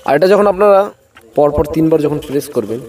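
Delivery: ordinary delivery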